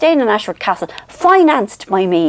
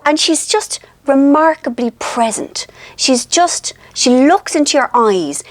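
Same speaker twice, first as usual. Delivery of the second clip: ordinary talk, nothing marked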